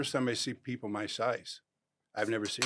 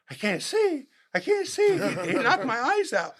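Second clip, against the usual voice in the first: high laughing scream, much higher and louder; mock screaming; 6 dB louder than usual. Blinded by the hit, he screams to the field, half laughing and much higher. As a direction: yelp it in mock panic, laughing. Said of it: "I was started screaming".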